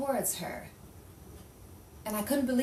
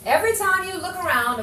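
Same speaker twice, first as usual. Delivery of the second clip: higher and louder, fear spilling over; panicked, raised voice; frightened outburst; 9 semitones higher, 9 dB louder than usual. Acted. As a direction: make him see the danger. Frightened witness pleads her fear.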